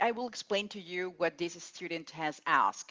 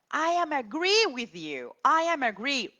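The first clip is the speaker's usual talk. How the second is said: much higher, louder imitation of a student; higher mock student voice on "I am agree"; mock student error; 9 semitones higher, 6 dB louder than usual. Shown as a demonstration. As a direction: play the student confidently getting it wrong. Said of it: "Wrong again".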